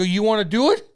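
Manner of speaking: loud, high dare to fight; hammed-up retold yell; shouted challenge. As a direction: yell it, spoiling for a scrap.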